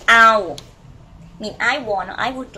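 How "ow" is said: "Ow" said loud on an even tone; model pronunciation. Shown as a demonstration. Teacher asking students to repeat, loud model "Ow". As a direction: give "Ow" loudly for learners to repeat. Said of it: "Can you pronounce after me".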